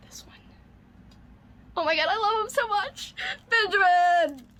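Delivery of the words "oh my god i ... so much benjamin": high-pitched delighted squeal; overjoyed gushing. Real, not acted. Gift receiver to generous friend, squealing with delight. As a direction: gush high, then call the name out loudly.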